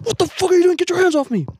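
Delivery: high mock angry shout; retold aggressive yell. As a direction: bark it like a crazy guy on the bus. Retold crazy man yelling.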